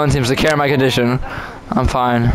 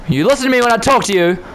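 mock scolding; 7 semitones higher, faster than usual. Mock scolding another player.

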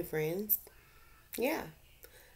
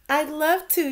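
much higher and louder, delighted; happy yes; 12 semitones higher, 10 dB louder than usual. Acted. Rita accepts Gilbert's invitation to play, delighted and high.

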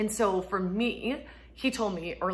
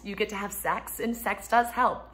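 normal talking voice

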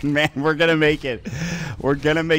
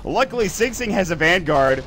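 excited play-by-play; 6 semitones higher, 6 dB louder than usual. Real, not acted. Hyped play-by-play as fight unfolds.

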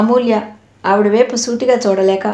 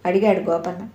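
usual speaking voice

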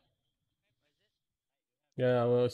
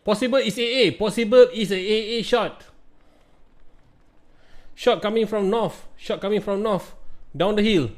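shouting; 8 semitones higher, 6 dB louder than usual. Real, not acted. Firefight callouts.

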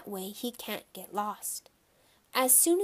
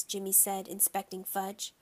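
ordinary delivery

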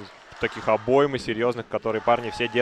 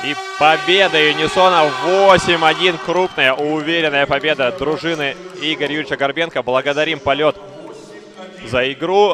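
excited victory call; 4 semitones higher, 6 dB louder than usual. Real, not acted. Final siren, announcing the win.

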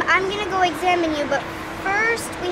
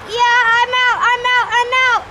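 higher, louder relieved cry; relieved excited shout; 7 semitones higher, 6 dB louder than usual. Acted. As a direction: blurt it out, freed at last. Ken freed from motorcycle.

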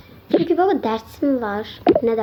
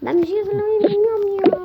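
crying whine; 8 semitones higher than usual. Acted. Whining she can't cook.

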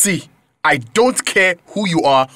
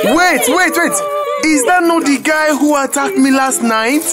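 alarmed recognition; 10 semitones higher than usual. Acted. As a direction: stop him short in shock.

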